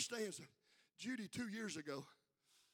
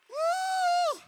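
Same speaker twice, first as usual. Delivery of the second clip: high loud preacher whoop; excited whoop; 16 dB louder than usual. Real, not acted. Preacher to church, a high excited whoop. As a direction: let out a joyful whoop.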